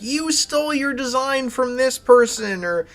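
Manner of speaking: mock the accusers in a high whine; mocking imitation. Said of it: "it's like".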